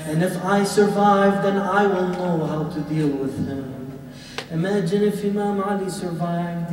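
loud, high preaching at full force; fiery sermon. Acted.

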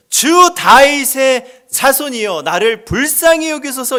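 loud slow plea, stretching 주; crying out. Retold or imitated. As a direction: call out to the Lord, pleading. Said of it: "소리질러 이르되". (간절하게 외치는 목소리)

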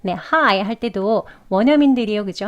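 ordinary talk

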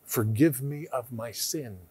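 soft, pleading prayer voice; hushed prayer. Shown as a demonstration. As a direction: lead the sinner's prayer gently. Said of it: "Just pray out loud and say".

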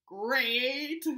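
plain reading voice

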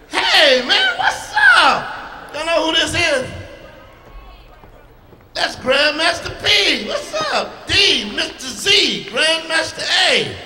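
high, loud excited greeting; excited shout. Real, not acted. Comic hollering at an old friend in the crowd.

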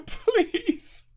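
high, breathy laughing whine, slow; laugh-crying despair. Real, not acted. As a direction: laugh helplessly at your own failure.

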